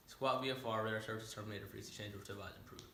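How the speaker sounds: rattled-off controller patter, trailing away; fast radio talk. Retold or imitated. Controller signing off to the pilot, rapid patter. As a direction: reel off the radio call quickly and softly. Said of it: "When you talk that fast".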